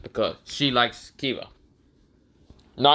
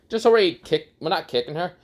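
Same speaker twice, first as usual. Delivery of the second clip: ordinary delivery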